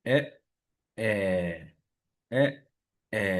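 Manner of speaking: এ held a little long, open sound; teacher drilling a long এ. Shown as a demonstration. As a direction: stretch each এ slightly. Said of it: "একটু long হবে এ".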